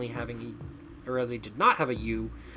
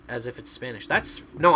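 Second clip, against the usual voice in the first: normal talking voice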